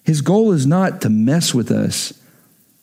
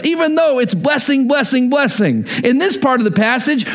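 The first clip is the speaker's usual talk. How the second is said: ordinary talk, nothing marked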